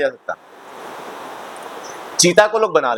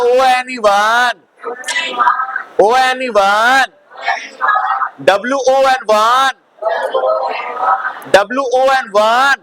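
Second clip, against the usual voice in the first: higher, slow spell-it-out teacher voice; classroom spelling drill; 5 semitones higher, much slower than usual. Acted. Teacher compares spellings of one and won, drilling voice. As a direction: spell it out, stretching the final word.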